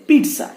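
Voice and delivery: "pizza" as PEET-sa, high; TS pronunciation. Shown as a demonstration. Teacher closing the correction, TS pronunciation. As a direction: say "pizza" with a crisp T-S. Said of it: "is pizza".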